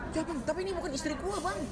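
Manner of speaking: high, alarmed acted protest; protesting husband. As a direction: object that there's been a mistake. Suami protes salah orang. (protes dengan suara tinggi)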